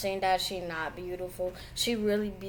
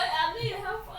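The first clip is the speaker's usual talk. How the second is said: laughing; 11 semitones higher, 3 dB quieter than usual. Real, not acted. Daughter on floor laughing.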